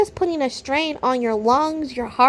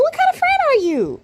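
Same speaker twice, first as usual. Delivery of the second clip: high-pitched indignant question; scolding, squeaking up high; exasperated outrage; 12 semitones higher than usual. Real, not acted. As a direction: let the voice shoot up in disbelief. Ranting at woman in video.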